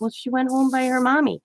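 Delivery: retold grandma-to-grandchild voice; grandma explaining. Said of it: "I'm like".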